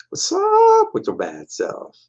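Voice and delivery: very high, slow, put-on buddy voice; very high, slow cartoon greeting; cool dude greeting. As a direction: drawl a chummy hello in a squeaky voice. Metal-armed guy greets robot.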